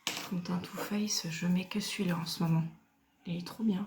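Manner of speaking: hushed confiding whisper about the foundation; soft whisper. Real, not acted. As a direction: whisper it like a secret tip to the camera. Whispered product aside.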